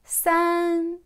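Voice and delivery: "San" held high and level; first tone. Shown as a demonstration. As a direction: sustain "San" loud on one high note. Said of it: "Premier ton".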